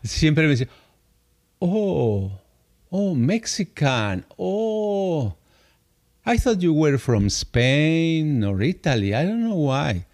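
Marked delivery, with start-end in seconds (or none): 1.6-10.0 mock pitying, drawn-out "oh"; condescending pity. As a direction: imitate someone slowly condoling, as if at bad news. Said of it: "como diciendo, oh, estás paralítico".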